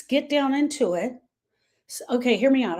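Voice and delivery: normal talking voice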